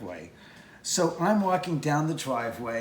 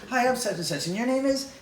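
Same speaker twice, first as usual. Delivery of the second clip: assistant director; 9 semitones higher, 5 dB louder than usual. Retold or imitated. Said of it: "the second A D comes up and goes".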